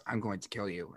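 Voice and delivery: lightly done flat monotone threat. Retold or imitated. Host retells the caller's last threat in monotone. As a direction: say it dead even, no tune. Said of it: "in monotone".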